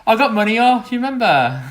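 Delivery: high, loud, hammed-up Brummy accent. Teasing friend about money off, exaggerated Brummy accent. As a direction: put on a Brummy voice, pushed up high. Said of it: "have a hard Brummy accent".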